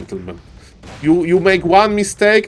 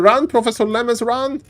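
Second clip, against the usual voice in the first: 1 excited urging; 6 semitones higher than usual. Real, not acted. Caster urging player to flee.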